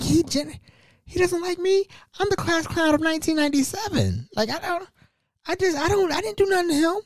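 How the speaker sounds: high-pitched wounded disbelief; acted-out baffled objection; surprised protest. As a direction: plead innocence in a high voice. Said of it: "I was generally surprised".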